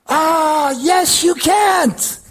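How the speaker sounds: high, drawn-out mocking devil; acted taunting voice of the devil; devil's taunt. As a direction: stretch it slowly, pitched high, to discourage. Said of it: "Le diable, il dit".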